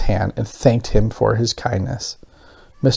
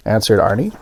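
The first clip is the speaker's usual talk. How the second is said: ordinary delivery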